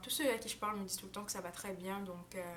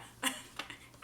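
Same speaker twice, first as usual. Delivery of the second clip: soft laugh; 4 dB quieter than usual. Real, not acted. Laughs about mom's age.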